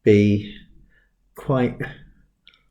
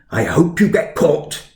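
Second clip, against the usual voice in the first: higher-pitched mock scolding, Welsh-tinged; finger-wagging head teacher; 6 semitones higher, 3 dB louder than usual. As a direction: imitate a headmaster ticking someone off. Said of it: "wagged a finger and said".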